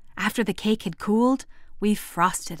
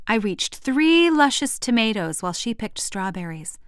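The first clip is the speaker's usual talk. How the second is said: ordinary delivery, nothing marked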